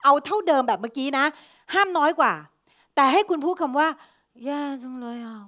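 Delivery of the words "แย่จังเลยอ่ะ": very slow, much softer, drained and limp; feeble voice. Shown as a demonstration. Teacher showing the class the weakest voice, feeble and limp. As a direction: let the voice sag as weak as it can. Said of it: "เอาให้เสียงอ่อนก๋อยที่สุดเลยนะคะ".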